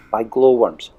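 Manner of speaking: plain reading voice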